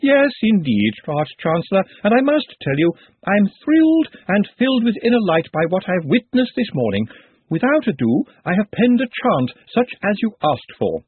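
high, eager music professor; lightly voiced excitable professor; thin reedy voice. Ritornello's reedy enthusiasm.

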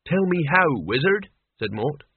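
usual speaking voice

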